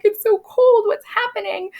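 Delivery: very high imitation of panicky girls; mock-alarmed girls. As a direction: act out the girls feigning alarm. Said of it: "they're all sitting in court".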